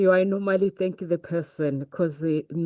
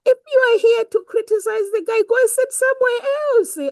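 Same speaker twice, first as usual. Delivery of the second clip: much higher, louder and fast, half laughing; heated rebuke; 14 semitones higher, 6 dB louder, faster than usual. Retold or imitated. Telling off a critic at Andy Harrington's event, heated. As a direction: tell him off in a rush. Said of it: "I was like".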